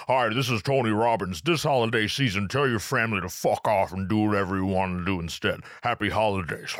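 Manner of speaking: deep motivational voice, slight lisp; Tony Robbins. Retold or imitated. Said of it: "That's how Tony Robbins talks".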